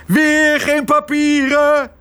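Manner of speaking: high, drawn-out roar of outrage; enraged bellow. Acted. Father furious at leaving without papers, enraged high roar. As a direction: bellow every word at a high pitch. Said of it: "brulde Tos".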